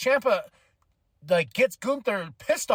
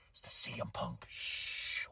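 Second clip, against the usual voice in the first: hushed, secretive whisper ending in a shush; conspiratorial whisper; 12 dB quieter, slower than usual. Pretend. Voicing fans harping on a rumour, conspiratorial whisper.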